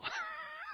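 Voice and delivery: brief high amused laugh; a quick chuckle at yourself; short laugh. Real, not acted. Laughs at own pronunciation.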